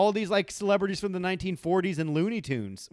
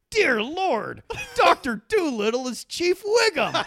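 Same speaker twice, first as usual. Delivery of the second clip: higher, slowing mock gasp of discovery; mock shock; 10 semitones higher, 4 dB louder, slower than usual. Fan reacting as the mustache falls off, hammed-up surprise. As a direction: play up a fake revelation. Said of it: "I was like".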